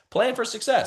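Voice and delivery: plan for success, pitched high; call-and-response lead. Shown as a demonstration. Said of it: "Everyone say".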